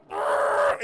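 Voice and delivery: loud, rough "argh"; imitated agonized cry. Gnome sucked up by a leaf blower, mock agony. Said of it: "And he's like".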